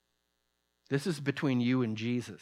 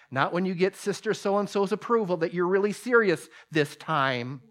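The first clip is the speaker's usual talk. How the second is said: ordinary delivery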